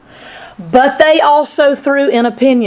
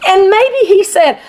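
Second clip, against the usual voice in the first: usual speaking voice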